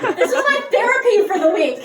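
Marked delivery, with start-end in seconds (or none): 0.0-0.2 mock couple bickering over hanging up; playful back-and-forth, rising to a shout; giggly late night call. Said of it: "they were just going".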